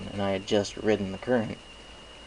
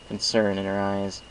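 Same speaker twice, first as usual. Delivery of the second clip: ordinary delivery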